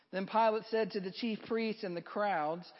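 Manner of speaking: normal talking voice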